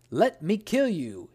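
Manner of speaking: the genius bellows a threat at the merchant; genius shouting. Acted. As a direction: roar it as the genius. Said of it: "he shouted".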